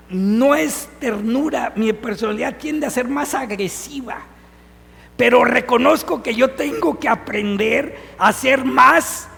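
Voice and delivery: ordinary talk, nothing marked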